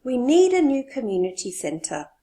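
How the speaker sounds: need punched; stress on need. Shown as a demonstration. Teacher shows the stress on need. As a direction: lean on need. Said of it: "This person wants to stress the need".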